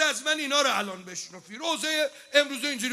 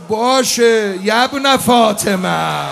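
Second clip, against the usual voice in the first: loud, very slow mocking call; Shimr's coded taunt; 12 dB louder, much slower than usual. Preacher voicing Shimr's taunting signal to his men, loud slow taunt. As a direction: drag out the taunt cruelly. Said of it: "گفت".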